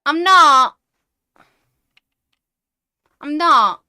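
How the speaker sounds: loud offended protest; indignant. Real, not acted. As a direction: shout the denial, offended. Angry denial of being American.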